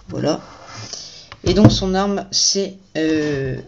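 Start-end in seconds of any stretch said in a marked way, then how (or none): none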